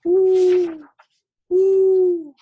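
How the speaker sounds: high, loud owl hooting; owl hoots. Acted. Owl scolding the rabbit, loud high hooting. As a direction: hoot it out like an old owl. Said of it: "said a fat old owl".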